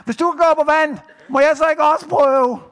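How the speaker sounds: high, loud call across the water; eager shout. Acted. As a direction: holler the request to someone far off. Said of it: "så råber han nemlig".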